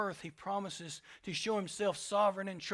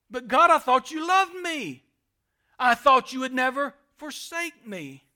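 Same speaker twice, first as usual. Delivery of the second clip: higher and much louder, a pained cry; anguished plea to God; 4 semitones higher, 11 dB louder than usual. Acted. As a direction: cry out to God in hurt disbelief. Believer crying out to God.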